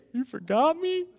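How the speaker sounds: put-on a kid playfully wounded, cracking up; mock hurt child. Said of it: "Can you imagine".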